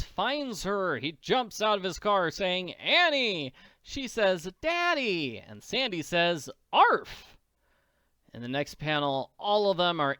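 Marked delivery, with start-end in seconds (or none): 2.7-3.5 higher, louder call of her name; a man overjoyed to find her; gruff Daddy Warbucks calling. Acted. Warbucks jumps from his car calling Annie. 4.6-5.4 much higher happy cry; a little girl thrilled to see him; Annie's glad cry. Acted. Annie calls out to Daddy Warbucks in joy. 6.7-7.4 high, loud yap; dog bark. Acted. Sandy barks to greet Warbucks.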